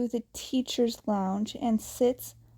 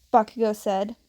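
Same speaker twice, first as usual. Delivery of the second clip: plain reading voice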